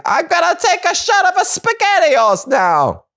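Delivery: hammed-up road-rage Italian; mock Italian yell. Althea cut off in traffic, in a mock Italian shout. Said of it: "She's like".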